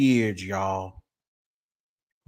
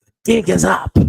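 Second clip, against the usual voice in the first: imitated busted couple; 7 semitones higher, 10 dB louder than usual. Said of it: "and they are going to say".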